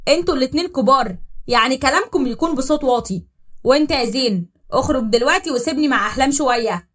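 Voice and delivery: الجد يزعق بصوت أعلى وحازم; زعقة جد يفض الخناقة; بصوت عالي. Acted. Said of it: "اتكلم جده بصوت عالي". (الجد يزعق في الاتنين بحزم)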